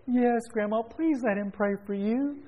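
high, drawn-out plea of a granddaughter; pleading granddaughter. Retold or imitated. Granddaughter begging grandma to accept prayer, high mock plea. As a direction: imitate a child pleading, voice pitched high.

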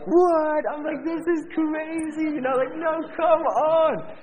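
very high, loud squeal of disbelief; hammed-up amazement, slow stretched words; astonished. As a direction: shriek it like you can't believe it. Amazed reaction to the miracle.

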